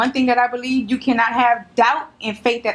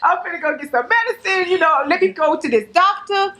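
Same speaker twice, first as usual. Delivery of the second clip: higher, pleading mock voice to mom; imagined plea to mom; 7 semitones higher than usual. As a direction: play it as a daughter begging her mom, higher. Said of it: "I would've been like".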